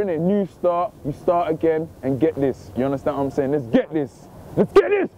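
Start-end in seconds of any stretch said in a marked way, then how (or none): 4.6-5.1 sudden higher-pitched hype yell; rallying shout. Real, not acted. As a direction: fire up the viewers with a burst. Rallying viewers, repeats it shouted.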